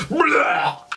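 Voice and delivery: a high, sudden vomiting noise; a mate on the summit climb, a loud mock retch; imitated retch. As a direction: mimic a mate throwing up. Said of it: "And he goes".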